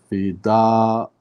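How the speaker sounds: "fida" slow, final aa held out; unfinished word. Shown as a demonstration. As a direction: hang on the end of "fida", leaving it open. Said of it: "I don't know how to end that".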